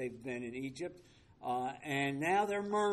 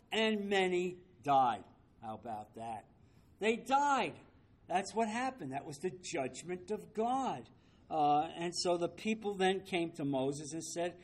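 ordinary talk, nothing marked

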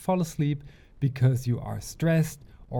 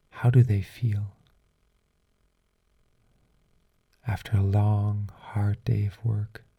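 guided sleep meditation; 4 semitones lower, 6 dB louder than usual. Acted. Host guides listeners into a calm and relaxed state, guided meditation. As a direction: ease the listener toward sleep, unhurried and lower-pitched.

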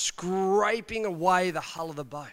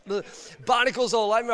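ordinary talk

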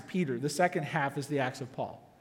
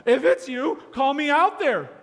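eager; 9 semitones higher, 7 dB louder than usual. Retold or imitated. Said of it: "he doesn't think before he talks".

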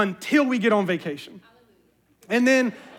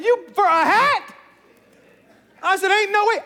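mock outrage; 8 semitones higher than usual. Husband balks at the wife's twenty-five dollar hat, outraged. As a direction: balk at the price of a hat.

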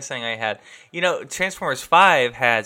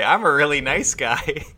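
mock villain voice; 2 semitones higher, 7 dB louder than usual. Mocking friend as bad guy.

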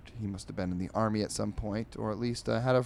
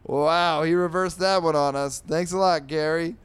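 loud, high reaction to a twist; amused amazement; 6 semitones higher, 6 dB louder than usual. Real, not acted. Reader reacts to the reversed punchline, loud amazement. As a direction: exclaim it loud and high, impressed.

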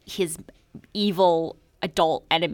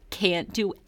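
usual speaking voice

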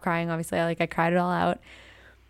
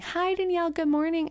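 high sing-out morning greeting; high-pitched mock-friendly hello; cheery classmates; 11 semitones higher than usual. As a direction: imitate chirpy classmates, pitched way up.

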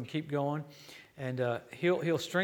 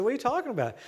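high, incredulous question; confused question; 7 semitones higher, faster than usual. Retold or imitated. Preacher asking the woman about her screw, incredulous. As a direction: sound completely lost.

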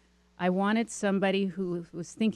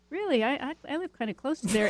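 much higher, surprised quoted friend; retold surprise; 7 semitones higher than usual. Widow imitating Denise's surprise on the call, high pitched. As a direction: pitch jumps up in mock surprise.

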